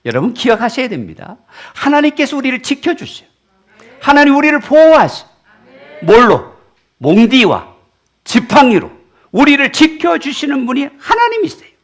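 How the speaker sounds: loud, high, ringing sermon voice; fiery preacher calling out; preaching at full force. Acted. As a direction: drive it home to the congregation.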